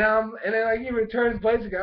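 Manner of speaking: ordinary talk, nothing marked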